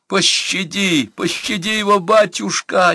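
very high, drawn-out begging; desperate, high-pitched supplication; insistent wailing plea. A narrator's reading. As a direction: keep begging in a high wail. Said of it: "не унималась Марфа".

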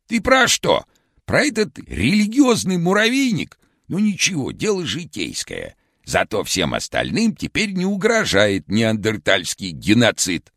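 very high, hoarse cartoon shout; vintage cartoon voice. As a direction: shout it like an old cartoon Karlsson. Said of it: "хриплым, как в старом мультике".

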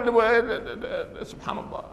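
a stammering, pained sigh of sympathy; imitating a friend who aches with you. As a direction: choke on the words, then breathe out سبحان الله. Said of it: "أو يتألم".

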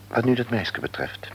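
ordinary talk

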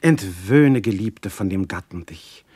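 normal talking voice

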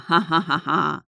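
gloating sea-king laugh, cut off sharply; triumphant evil laughter; villainous laugh. A narrator's reading. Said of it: "Смех резко оборвался". (злорадный смех)

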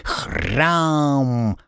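garbled parrot squawk; bird folk. Acted. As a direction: squawk it unclearly. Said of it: "не очень внятно объяснил попугай".

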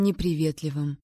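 plain reading voice

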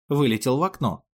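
plain reading voice

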